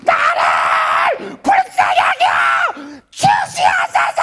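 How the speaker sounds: very slow, very high, loud wailing cry. Acted. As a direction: stretch the cry out as long as you can. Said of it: "이렇게 부르짖었어".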